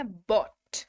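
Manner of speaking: बॉट said clearly with an open o and a hard final t; pronunciation of bought. Shown as a demonstration. Teacher showing students how to say बॉट. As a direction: spell out बॉट slowly as "bot". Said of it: "उच्चारण ध्यान दीजिएगा".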